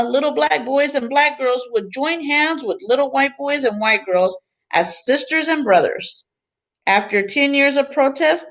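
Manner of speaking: expressive picture book reading; reading to children. Acted. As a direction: read the book to the class with gentle emphasis. Teacher reads picture book aloud.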